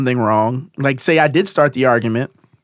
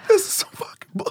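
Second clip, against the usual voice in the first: very high, squeaky complaint; retold outraged whine; 6 dB quieter than usual. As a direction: squeal it out like a hurt, indignant kid. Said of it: "And I'm like".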